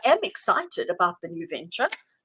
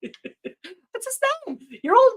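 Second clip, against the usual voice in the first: much higher, slow, laughing through the words; laughing plea; 12 semitones higher, 5 dB louder, much slower than usual. Real, not acted. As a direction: giggle it out while begging for the name. Laughing, can't recall actor.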